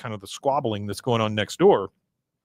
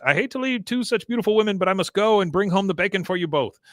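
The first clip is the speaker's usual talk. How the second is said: higher-pitched, quick put-on farewell; acted gallant farewell; 7 semitones higher, faster than usual. Recapper voices Khan leaving two women for work, acted gallant farewell. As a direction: play a showy goodbye, pitched up and hurried.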